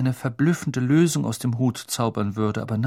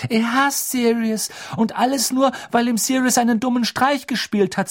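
put-on high, loud, despairing girl; desperate Hermine; 12 semitones higher, 5 dB louder than usual. A narrator's reading. Hermine desperately defending Sirius to Dumbledore, high pitched. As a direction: protest in despair. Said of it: "sagte Hermine verzweifelt".

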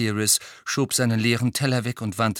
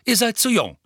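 high, firm final word; stern mother; 13 semitones higher, 5 dB louder than usual. A narrator's reading. Mother telling the children they are too young, stern. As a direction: shut the question down firmly. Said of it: "sagte Misses Weasley".

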